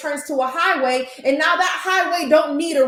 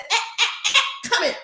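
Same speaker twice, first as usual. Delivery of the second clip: high laughing "eh, eh, eh"; playful mom busting her kid; mock stern mom, laughing; catching son out; 10 semitones higher than usual.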